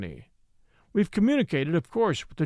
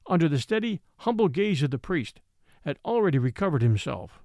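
plain reading voice

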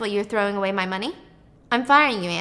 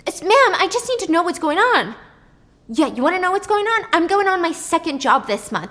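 high, loud bitter tirade; angry outburst; 4 semitones higher, 5 dB louder than usual. Retold or imitated. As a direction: lash out at him. Waitress lashes out at Nick.

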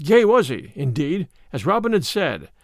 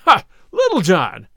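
laughing; 6 dB louder than usual. A narrator's reading. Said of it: "burst into a roar of laughter".

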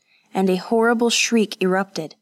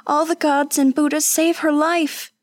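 high, pleading cry of terror; frantic prayer for the dying girl; terrified plea; 8 semitones higher than usual. A narrator's reading. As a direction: beg the gods in panic. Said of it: "Kazuko's voice rose with terror".

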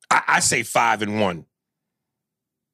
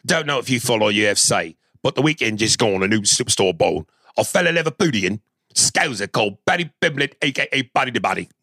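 put-on quick, rough stab at a Scouse accent; attempted Scouse accent. Host reading a Liverpool fan's email, mock Scouse accent. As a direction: read it briskly in an amateur Liverpool accent. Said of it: "give you a taste of what the Scouse accent is".

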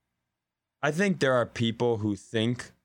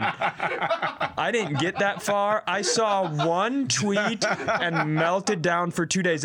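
laughing; 7 semitones higher, much slower than usual. Real, not acted. Laughing while telling.

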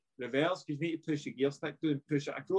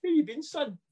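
imitating the gaffer; 12 semitones higher, 3 dB louder than usual. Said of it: "he's like".

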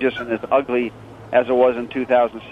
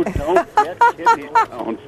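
jokey warning; slower than usual. Real, not acted. Warning joke about kidney stones.

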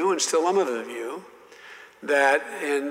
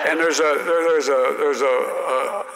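ordinary talk, nothing marked

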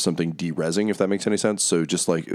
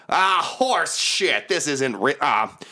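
much higher, slow, scornful hag voice; Mad Maggie scoffing; 9 semitones higher, slower than usual. Acted. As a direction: spit out the curse, drawn out and high. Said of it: "Mad Maggie's voice all around you be like".

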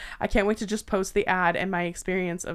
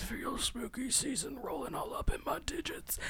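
whisper; 3 semitones higher, 13 dB quieter than usual. Acted. Spooky-season shiver, whispered.

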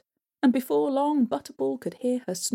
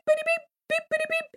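very high excited beeping; excited squeak. Acted. Butterball bursts out, high excited beeping. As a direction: squeak with eagerness.

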